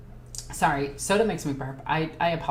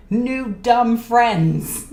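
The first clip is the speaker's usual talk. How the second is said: slow, loud, higher dopey shark man; dumb King Shark voice; 6 semitones higher, 7 dB louder, much slower than usual. Pretend. Describing the orcs as King Shark, in a slow, loud, dopey voice. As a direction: drag it out like a happy dim brute. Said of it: "basically King Shark from the new Suicide Squad".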